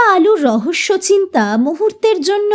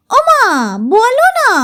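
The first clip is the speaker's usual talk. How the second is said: put-on pleading child; 9 semitones higher than usual. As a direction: beg mother insistently, high. Child nags mother. (উঁচু গলায় শিশুর আবদার)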